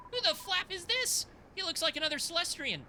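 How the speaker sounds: high, bright-eyed sidekick voice; perky fairy girl. Acted. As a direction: squeak it out like a startled little fairy.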